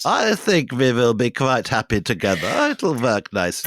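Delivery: hammed-up German accent. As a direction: speak as a German prince with a thick accent. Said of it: "imagine this in a thick German accent".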